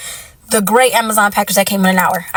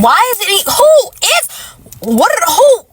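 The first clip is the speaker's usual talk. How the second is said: flustered disbelief; 8 semitones higher than usual. Real, not acted. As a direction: sputter in disbelief, pitch climbing. Flustered questions.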